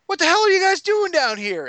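high, loud, indignant challenge; angry Bertram. Retold or imitated. Bar owner catching adventurers in his cellar, indignant shout. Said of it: "I had Bertram show up and he goes".

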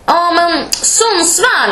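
high, drawn-out mimicked guess; colleague's confident guess. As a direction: stretch it out as the coworker guessing.

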